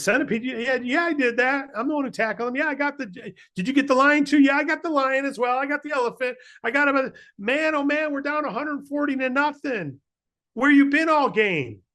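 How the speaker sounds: very high, eager little bug voice; excited bug voice. Acted. Insects cheering the centipede, in a very high voice. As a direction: chirp it out fast and proud. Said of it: "someone says".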